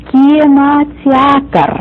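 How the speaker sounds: Kinoteatr drawn out slowly syllable by syllable; slow, careful teacher demo; slow model word. Shown as a demonstration. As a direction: stretch Kinoteatr out for learners. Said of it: "this is going to be a longer word".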